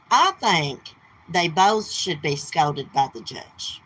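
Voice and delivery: normal talking voice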